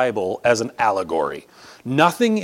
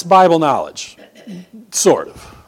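usual speaking voice

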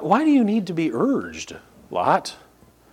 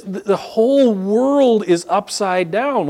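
ordinary talk, nothing marked

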